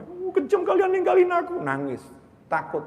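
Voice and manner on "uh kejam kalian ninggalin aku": very high, whiny mock protest; mock upset complaint. Frightened person abandoned by friends, hammed-up upset wail. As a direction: imitate someone tearfully scolding friends. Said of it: "Marah sama temennya".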